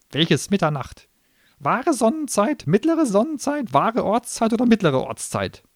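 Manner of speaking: high, loud clerk-like gnome voice; businesslike gnome. Acted. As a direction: recite the terms like a contract. Gnome pedantic.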